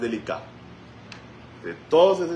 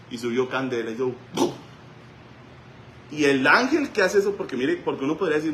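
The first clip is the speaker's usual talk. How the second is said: usual speaking voice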